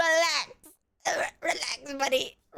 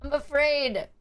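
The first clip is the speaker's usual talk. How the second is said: ordinary delivery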